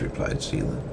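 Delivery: ordinary delivery, nothing marked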